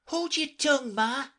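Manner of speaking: high, loud snap at mother; a little snappishly, bratty. A narrator's reading. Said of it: "a little snappishly".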